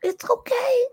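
high, tearful, consoling voice; God. Acted. God comforting a daughter after her pizza, high and tearful. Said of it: "He said".

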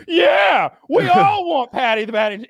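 high, loud mock crowd shout; crowd roar. Crowd answering the fighter, loud high cheer. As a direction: yell it like a stadium full of fans. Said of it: "the crowd's like".

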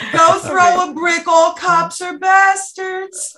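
slow, high witch voice. Pretend. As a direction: draw it out like a witch. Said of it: "that witch voice we were practicing earlier".

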